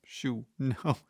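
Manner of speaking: ordinary delivery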